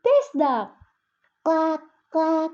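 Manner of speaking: high, loud toy duck quack; playful duck quack. Acted. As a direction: make the toy quack for the baby. Sister playing with rubber toy.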